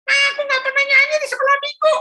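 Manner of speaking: put-on high childish whine; a grumpy kid answering his mum; sulky boy. Philip grumbling back at his mother, high whiny child voice.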